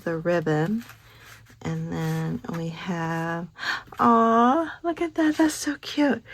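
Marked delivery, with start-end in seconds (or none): 4.0-6.2 high, cooing gush over something cute; a high-pitched aw over a cute card; cooing delight. Real, not acted.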